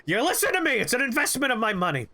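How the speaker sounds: high, insistent boss yell; imitated shouting. Boss demanding the student go into business, shouting. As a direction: mimic a boss hollering his demands. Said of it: "he's just shouting at me".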